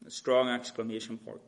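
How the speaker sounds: ordinary talk, nothing marked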